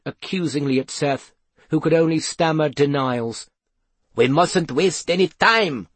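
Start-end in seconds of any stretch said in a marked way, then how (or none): 4.2-5.9 higher, urgent growl. A narrator's reading. Mother urges the searchers on, growling. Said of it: "growled Henri".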